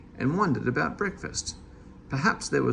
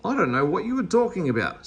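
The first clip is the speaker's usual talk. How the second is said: cross; 5 semitones higher, faster than usual. A narrator's reading. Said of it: "said Meddle crossly".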